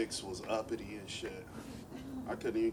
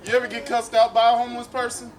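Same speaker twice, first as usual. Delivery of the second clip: worked-up rant; 6 semitones higher, 7 dB louder than usual. Real, not acted. Comic rant building, louder.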